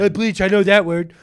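loud, high blurted impression; Trump. Imitating a president grabbing a familiar word, blurted Trump impression. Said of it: "He just fucking spit that one out".

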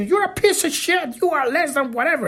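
high mocking put-down, fast at the end; sneering bully. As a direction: play the jerk putting you down. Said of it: "their asshole comes and tells you".

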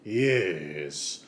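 a quick French-accented "Yes"; a hint of a French accent on "Yes". Shown as a demonstration. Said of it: "my usual French accent".

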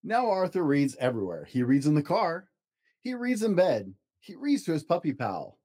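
storybook narration, gentle and lively; reading to children. Acted. Dad reads picture book to child, storybook voice. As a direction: read it aloud to a child at bedtime.

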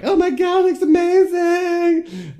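very high, loud mock squeal; imitating girls shrieking with delight; hammed-up amazement. Friends marvel at blonde hair.

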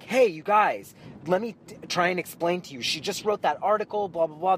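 calm them down, a bit urgent; trying to reason. Retold or imitated. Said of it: "I'm like".

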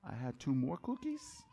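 put-on small, soft innocent child voice; Noah. Little boy confessing about cookies, innocent. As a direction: ask very softly, rising like an innocent little kid. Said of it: "He's so innocent when he says it".

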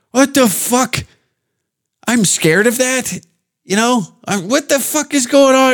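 high-pitched incredulous reaction; mock bewilderment. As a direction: play baffled disbelief, voice way up. Acting baffled reaction to accent.

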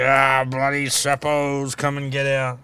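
slow, drawn-out mock ocker Australian accent; jealous Aussie soldier grumbling at Yanks in broad Australian accent. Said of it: "I don't know what accent that is".

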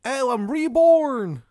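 very high, loud, slow mock wail; Shithead Steve. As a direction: bellow it high, dragging out the last word. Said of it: "It's Shithead Steve".

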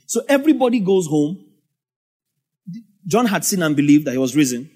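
usual speaking voice

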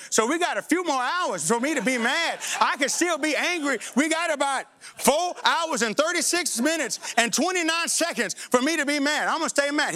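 stubborn pouting tone; petulant stubbornness. Retold or imitated. Petulant stalling argument.

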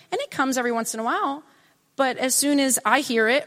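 usual speaking voice

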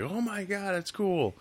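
high, soft mock fan voice; softly squealed fan reaction; gushing fans. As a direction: imitate a delighted fan, light and high. Voicing excited fans.

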